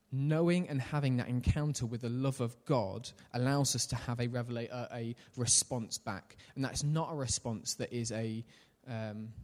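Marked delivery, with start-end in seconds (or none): none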